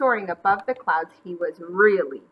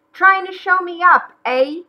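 high, loud indignant challenge; angry sputter; 9 semitones higher, 8 dB louder than usual. A narrator's reading. McGreely outdone by the bunnies, angry sputter. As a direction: sputter it with raised pitch. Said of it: "he sputtered".

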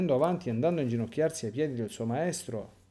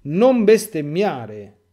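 loud, slow, stern rebuke; 10 dB louder, slower than usual. Acted. Jesus shakes Judas and rebukes him, loud and stern. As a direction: hammer each word as a harsh warning. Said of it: "scuote rudemente l'Iscariota dicendo".

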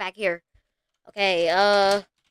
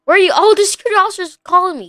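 ordinary talk, nothing marked

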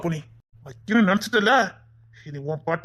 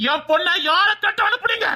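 furious shout; 7 semitones higher than usual. Acted. Father demanding who sent his daughter, furious shout. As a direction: yell the accusation at them.

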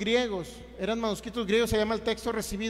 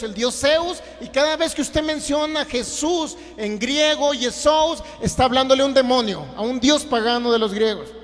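loud, raised imitation of alarmist accusers; emphatic retold accusation; 3 semitones higher, 5 dB louder than usual. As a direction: hammer out the opponents' claim loudly. Mocking retelling of opponents' accusation.

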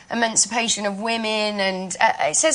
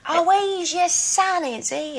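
put-on high, musical old Cockney lilt; sing-song period Cockney; old Cockney accent; 8 semitones higher than usual. Shown as a demonstration. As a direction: demonstrate Eliza's Cockney, starting high and dropping away. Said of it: "So it's all very musical".

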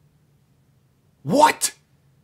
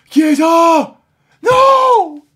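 loud, high cry at the miss; anguished scream; 18 dB louder, much slower than usual. Real, not acted. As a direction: scream as it misses. Near miss, gamer screams.

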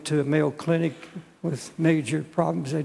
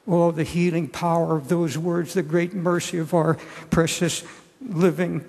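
ordinary delivery

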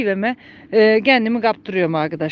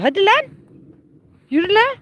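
high, rising prod; urging on; 8 semitones higher, faster than usual. Real, not acted. Owner telling the donkey to get walking, high urging call. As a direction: chivvy the animal along in a high voice.

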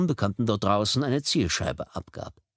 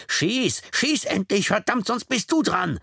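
high shouted inner chorus; screaming voices; 12 semitones higher, 4 dB louder, slower than usual. Acted. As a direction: yell him into pulling the trigger. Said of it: "schrien jetzt mehrere unbekannte Stimmen".

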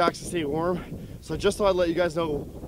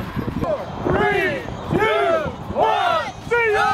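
shouted countdown, rising loud; 4 dB louder, much slower than usual. Real, not acted. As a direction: call the jump countdown loudly. Bungee jump countdown shout.

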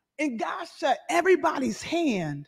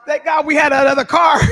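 louder, laughing out the thanks; laughing relief; 3 semitones higher, 7 dB louder than usual. Real, not acted.

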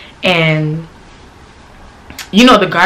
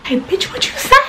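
mock angry man; 13 semitones higher than usual. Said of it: "He like".

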